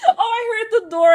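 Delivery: startled laugh breaking through the words; laughing startle. Real, not acted. Streamer startled by a door sound, laughing. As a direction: laugh it out, jumpy and giddy.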